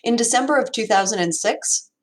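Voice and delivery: ordinary talk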